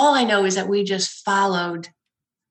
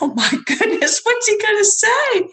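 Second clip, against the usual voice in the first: laughing; 13 semitones higher, 3 dB louder than usual. Retold or imitated. Her own retold shocked reaction.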